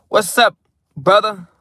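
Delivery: bright loud hey to his brother; excited greeting. Real, not acted. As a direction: call out happily to a friend. Greeting a friend warmly.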